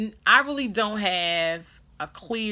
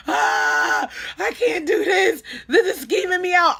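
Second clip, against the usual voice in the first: loud, high-pitched tearful freak-out; grossed-out panic; 13 semitones higher, 11 dB louder than usual. Retold or imitated. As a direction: re-enact a disgusted wail. Panics at mess in shower.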